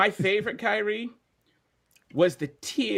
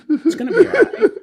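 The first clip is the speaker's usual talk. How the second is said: laughing reassurance; 13 semitones higher, 10 dB louder than usual. Real, not acted.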